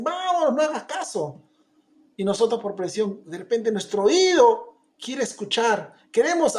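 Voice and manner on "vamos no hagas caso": high, brushing-off tone; dismissive friends. Retold or imitated. Friends pulling us away from the preaching, dismissive. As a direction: wave off the preaching. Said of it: "nos dicen".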